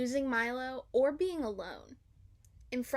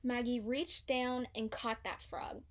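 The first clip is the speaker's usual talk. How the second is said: usual speaking voice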